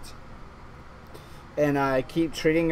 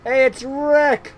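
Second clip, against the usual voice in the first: high, loud, slow cheerful hail; excited greeting; 10 semitones higher, 8 dB louder, slower than usual. Pretend. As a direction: greet an old buddy with delight. Greeting a character on screen.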